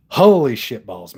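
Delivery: loud exclamation. Real, not acted. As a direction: blurt it out loudly. Exclaims after laughing.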